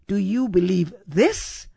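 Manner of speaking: "this" lifted into a rising question; "Do you believe THIS?", with "this" swept upward; stress on THIS. Acted. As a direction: hit the final word "this". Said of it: "emphasis on the last word in the question".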